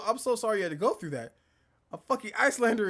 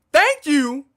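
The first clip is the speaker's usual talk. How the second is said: belted out, much louder; loud thank you; 6 semitones higher, 11 dB louder than usual. Real, not acted. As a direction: shout the thanks with gusto.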